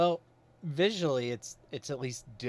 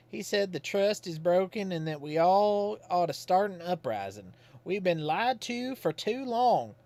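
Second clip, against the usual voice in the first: put-on folksy old Godfrey; 7 semitones higher, 5 dB louder than usual. Godfrey calls at Susie's house, folksy and higher. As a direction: play him as a simple, cheerful Southern townsman.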